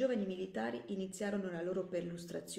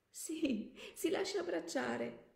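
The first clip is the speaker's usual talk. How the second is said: tearful; 10 semitones higher, slower than usual. Acted. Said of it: "completamente invaso dalle lacrime".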